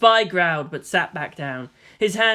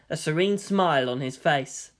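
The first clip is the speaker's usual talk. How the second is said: ordinary delivery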